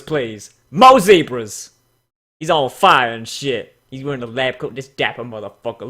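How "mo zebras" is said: slow, loud "Mo zebras" stretched out; drawn-out cheer. Real, not acted. Reviewer cheering for more zebras, slow loud chant. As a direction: chant "Mo zebras" slowly, savouring it.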